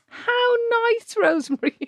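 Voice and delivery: high-pitched pointed fake niceness; mock sweetness. Reacting to praise for the co-host, mock sweetness. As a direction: coo it with exaggerated, sarcastic delight.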